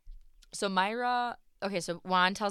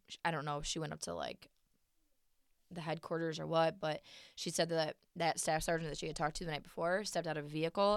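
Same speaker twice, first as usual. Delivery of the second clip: usual speaking voice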